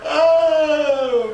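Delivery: high, loud unhinged laugh; manic laugh. Real, not acted. Laughing at himself for going insane, high unhinged cackle. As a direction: laugh like you're losing it.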